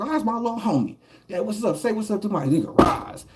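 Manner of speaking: high, easy introducing voice; cheerful introduction. Retold or imitated. The big homie telling his little homie to greet Roz, high and breezy. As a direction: wave the kid over casually.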